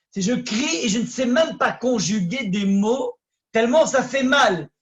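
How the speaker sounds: preacher voicing raw anguish; rising intensity. Real, not acted. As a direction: let the pain push the words up. Describing a cry of pain.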